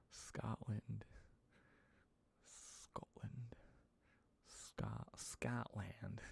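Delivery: whispered "Scotland", American "Scott" vowel; soft American "Scotland". Shown as a demonstration. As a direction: whisper "Scotland" over and over, testing it. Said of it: "You love the way Americans say Scotland".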